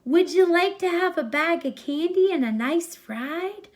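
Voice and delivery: bright, coaxing kidnapper's lure; Bill coaxing. Acted. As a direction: dangle the candy in a coaxing voice. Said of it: "says Bill".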